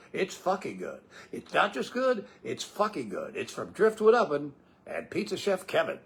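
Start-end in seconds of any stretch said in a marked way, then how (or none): 1.3-2.3 higher retake. Shown as a demonstration.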